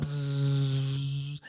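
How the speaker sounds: bzzz, loud and droning; loud bee buzz. Retold or imitated.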